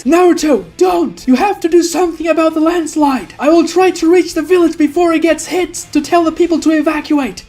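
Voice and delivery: high, pressing, fast call; urgent warning. Acted. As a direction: stop him and rush out the plan. Sasuke urgently stops Naruto, gives orders.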